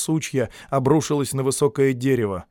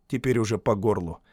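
ordinary delivery, nothing marked

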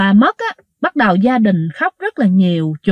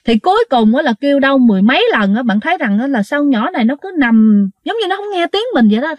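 plain reading voice

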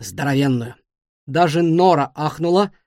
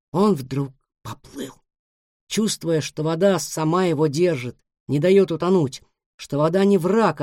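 ordinary delivery

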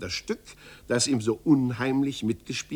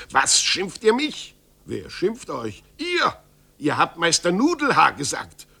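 angry accusation; 5 semitones higher, 5 dB louder than usual. Acted. Angry quarrel between two masters.

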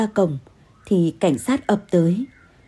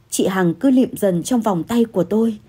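usual speaking voice

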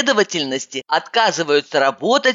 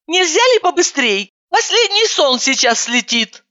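impatient; 9 semitones higher than usual. A narrator's reading. Impatient narrator.

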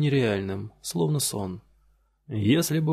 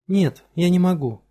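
firm, louder refusal; 7 semitones higher, 6 dB louder than usual. A narrator's reading. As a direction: say no and mean it. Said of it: "твёрдо сказала Фелиция".

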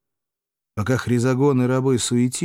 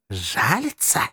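incredulous rising question; enraged; slower than usual. A narrator's reading. Dictator answers a plea for pity, mildly enraged. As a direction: throw the word back with restrained fury. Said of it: "кричал Сулла, выходя из себя от бешенства".

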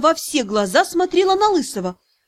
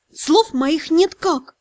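plain reading voice

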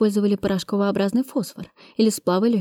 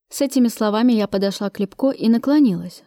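ordinary delivery

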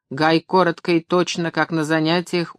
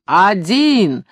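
loud, drawn-out single word; strained yell; 3 semitones higher, 6 dB louder than usual. A narrator's reading. Funk showing one finger to Maxim, yelling. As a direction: hammer it home at full volume. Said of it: "проорал Фанк сорванным голосом".

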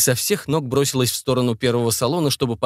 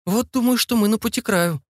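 wondering; 10 semitones higher than usual. A narrator's reading. Awed guess about being outside.

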